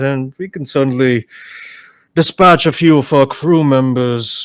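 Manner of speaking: low, calm measured voice; boss voice. Acted. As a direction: speak as the steady leader. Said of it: "Mead's like".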